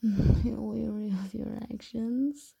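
ordinary delivery

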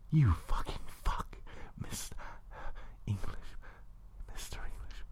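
hushed, acted voice of an overcome lover; lover's whispered reply. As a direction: gasp it softly in character.

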